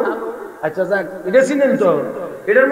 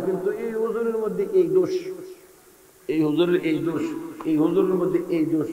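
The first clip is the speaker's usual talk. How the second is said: imitating critics in a hushed, lower voice; murmured fault-finding; 3 semitones lower, 9 dB quieter than usual. Congregant quietly finds fault with a cleric, mock murmur. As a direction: drop right down, like grumbling behind someone's back.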